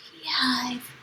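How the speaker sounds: "Beehive" whispered softly, no voice. Shown as a demonstration. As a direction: breathe the word "Beehive" as a hushed secret. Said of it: "Do you see the word beehive".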